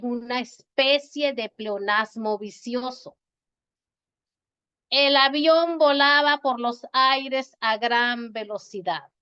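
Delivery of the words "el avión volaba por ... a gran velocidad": slow, louder read of the sentence; emphatic slow example sentence. Shown as a demonstration. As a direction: read the example slowly and clearly. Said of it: "no tengo que decir".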